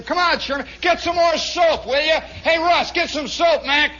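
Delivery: high, loud barracks hollering; acted gang calling out; rowdy shouting. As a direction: yell for supplies amid the horseplay. Men shouting over the scrubbing.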